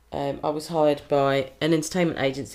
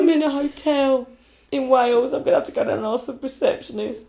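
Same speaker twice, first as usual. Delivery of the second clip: high comic wail, easing in volume; plaintive high-pitched fretting; mock panic; 9 semitones higher, 4 dB louder than usual. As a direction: join in the hammed-up panic. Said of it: "there's just this panic where".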